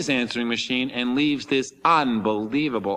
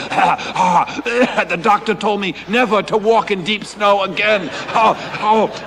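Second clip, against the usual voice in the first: put-on higher, louder panting old man's voice; mock out of breath; 6 semitones higher, 5 dB louder, slower than usual. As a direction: huff out the words like a winded man in snow. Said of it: "he puffing, popping heart pills".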